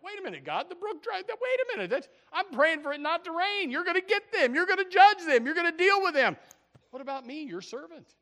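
high, loud, outraged protest to God; indignant complaint. Acted. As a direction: whine at God like a wronged servant. Preacher acts Elijah complaining to God.

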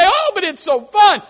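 high, loud, whiny brush-off of the warning; mock churchgoer. Churchgoer brushing off preacher's warning, high loud mock whine. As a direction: shout it like a carefree kid dismissing advice. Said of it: "And you say".

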